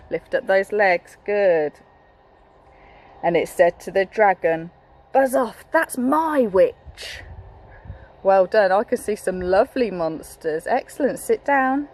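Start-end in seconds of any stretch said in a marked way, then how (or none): 5.1-7.2 higher, slow, drawn-out warning; a hint of a bossy beast; lightly voiced monster. Monster's speech in story.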